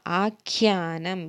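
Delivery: ആഖ്യാനം said slowly, leaning on the ഖ്യ cluster; careful spelling-out. Shown as a demonstration. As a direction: stretch ആഖ്യാനം so the aspirated ഖ്യ is clear. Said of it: "നഖത്തിൻ്റെ ഖ". (ആഖ്യാനം പതുക്കെ, ഖ്യ വ്യക്തമാക്കി)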